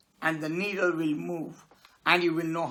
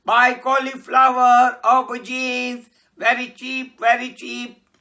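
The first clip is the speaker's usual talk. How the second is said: louder calling out wares; street vendor shout; 3 semitones higher, 8 dB louder than usual. Acted. Vegetable vendor calling out to buyers in the street. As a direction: call it out like a cart vendor.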